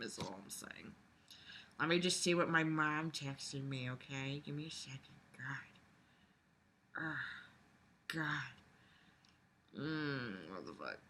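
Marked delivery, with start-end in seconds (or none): 2.6-11.0 breathless whispered groans to herself; real whisper. Real, not acted. Reading mom's text, whispered groans. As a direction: whisper your exasperation under your breath.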